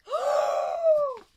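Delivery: high, loud gasp of delight; shrill delighted gasp. Real, not acted. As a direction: gasp sharply in wonder. Sees inside art.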